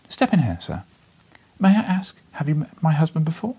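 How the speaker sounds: a hint of a vivacious lady, eager and urgent; urgent lady of the house, lightly voiced. Lady stops visitor in passage.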